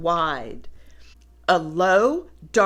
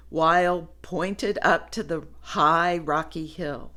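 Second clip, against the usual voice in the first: ordinary delivery, nothing marked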